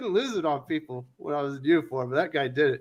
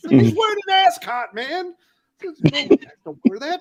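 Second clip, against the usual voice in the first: much higher, exaggerated mocking group voice; mocking chorus; 12 semitones higher, 4 dB louder, slower than usual. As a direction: play the whole table ribbing him in unison. Table mocks the waiter's ascot.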